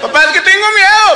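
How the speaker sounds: put-on high whiny child; pleading like a scared five-year-old; lightly voiced scared daughter; little girl voice. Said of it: "Y me habla".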